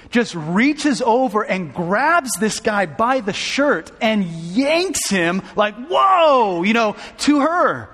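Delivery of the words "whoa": high, loud yanking whoa; playful shouted whoa; mock whoa shout. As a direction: shout it as the sudden yank. Daughter yanks man's shirt.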